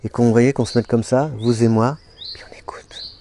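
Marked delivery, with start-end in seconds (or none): 2.3-3.2 soft whisper. Real, not acted. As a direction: breathe the words out. Invites quiet listening. (chuchoté)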